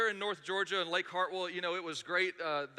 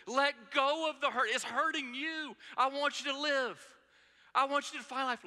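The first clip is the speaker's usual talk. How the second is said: impassioned pulpit plea, voice raised high; preaching; 7 semitones higher than usual. Acted. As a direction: plead with the congregation to let go. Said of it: "What Jesus is saying is".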